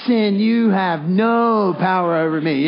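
loud and slow, "no" stretched long; stress on no, with attitude. Shown as a demonstration. Preacher leading everyone together, loud with "no" drawn out. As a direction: belt it out slowly, holding "no". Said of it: "say it together now".

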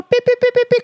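high, rapid imitation beeps; high-pitched mimicked reverse alarm; reversing beeper. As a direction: beep like a vehicle backing up. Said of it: "comecei a dar ré".

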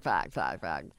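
echoing "fact", stepping down in pitch; mock echo effect. Mock jingle echo after segment title.

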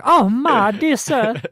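high, slow, prim mock lady voice; posh old-timey wife. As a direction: ham up an 1830s high-class wife. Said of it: "like, eighteen thirties wife?".

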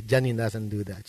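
ordinary talk, nothing marked

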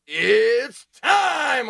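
loud, high, drawn-out ring call; hammed-up fight-night opener; fight announcer. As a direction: stretch each word like a ring announcer.

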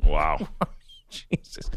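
normal talking voice

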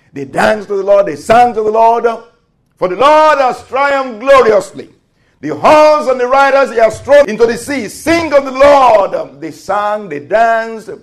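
high, loud, triumphant proclamation; full-force preaching. Acted.

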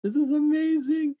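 high-pitched, thrilled retold yell; re-enacted ecstatic outburst; excited yelling. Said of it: "I start yelling. I'm so excited".

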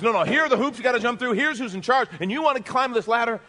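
high, loud, fast orders; acting a demanding taskmaster; bossy, pushy world. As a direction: rattle off the rules insistently.

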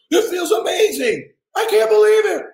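high, screaming joy; imitating a wildly excited son; ecstatic kid. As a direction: scream it like a boy who just won.

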